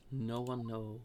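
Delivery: usual speaking voice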